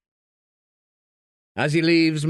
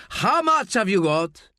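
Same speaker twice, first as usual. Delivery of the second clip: loud, higher, blustering boast; shouting; 8 semitones higher, 3 dB louder than usual. Acted. Gutierrez shouting at the bar to prove himself, loud and higher. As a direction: bang the bar and shout him down.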